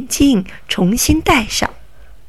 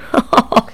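quick sly chuckle; a brief mocking laugh; robber's little triumphant laugh; short gloating laugh. Robber gloats over his trick.

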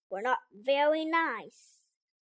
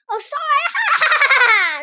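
fake sorry; 7 semitones higher, 7 dB louder, much slower than usual. Gremlin mock apology.

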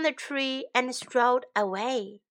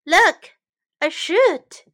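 excited cry; 5 semitones higher, 9 dB louder, slower than usual. Acted. Crowd sees the shoot appear.

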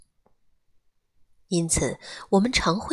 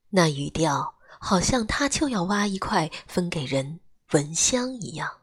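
ordinary delivery

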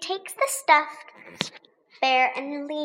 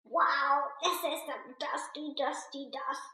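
softly voiced croak; croaky; 7 dB quieter than usual. A narrator's reading. Lambie coughing in the dust cloud, croaking. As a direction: croak it quietly, as if choked by dust. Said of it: "croaks Lambie".

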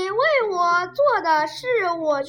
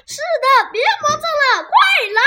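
着急大喊; 9 semitones higher, 6 dB louder, faster than usual. Acted. 西德催姨妈快上楼.

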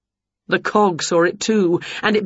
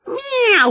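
mock meow. A narrator's reading. Cog noises in narration.

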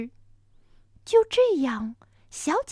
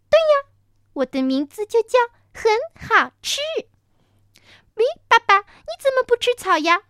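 put-on little child voice; 10 semitones higher, 6 dB louder than usual. Baby dinosaur chatters.

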